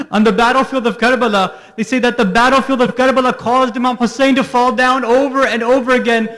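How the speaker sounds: high, loud grieving cry of a preacher; preaching at full force. Acted. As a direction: pour it out, near breaking. Climax of the Karbala lament.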